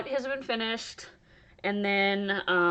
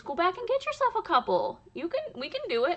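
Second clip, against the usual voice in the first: high, light husband impression; 10 semitones higher than usual. Husband quoted in a story.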